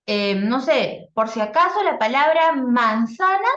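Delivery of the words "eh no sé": ordinary delivery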